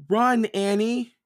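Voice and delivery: high, loud, drawn-out warning; crying out. A narrator's reading. Jack warns Annie away from the jaguar, high loud cry. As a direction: shout a high warning to run. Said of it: "cried Jack".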